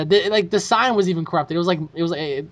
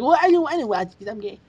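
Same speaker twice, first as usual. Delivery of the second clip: mocking friend voice; 6 semitones higher than usual. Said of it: "He was like".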